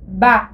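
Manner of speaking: ba said long and level, dragging tone. Shown as a demonstration. Teacher models ba with a level tone. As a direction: hold ba flat and drawn out. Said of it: "on prononce".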